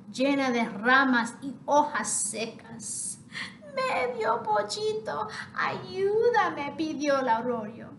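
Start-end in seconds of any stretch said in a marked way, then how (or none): 3.7-6.7 high, tearful plea for help; pleading water voice. Acted. Stagnant water begs the half chick for help, high and tearful. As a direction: beg like a dying stream.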